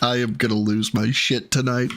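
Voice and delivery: usual speaking voice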